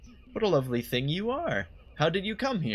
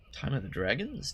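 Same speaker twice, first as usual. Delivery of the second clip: ordinary delivery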